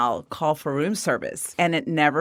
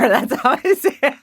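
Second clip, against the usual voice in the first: laughing; 8 semitones higher, 4 dB louder than usual. Real, not acted. Laughing at own self-image.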